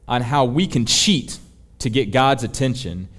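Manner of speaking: ordinary talk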